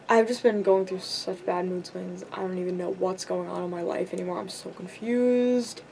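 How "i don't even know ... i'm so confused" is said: ordinary talk, nothing marked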